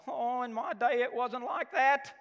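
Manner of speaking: a hint of a complaining pensioner; old grumbler. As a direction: lightly play a grumpy old man at the news. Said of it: "you know when you're getting old".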